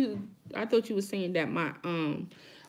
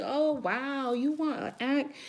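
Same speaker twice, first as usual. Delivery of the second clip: mock viewer voice; 4 semitones higher than usual. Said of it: "I know a lot of y'all probably like".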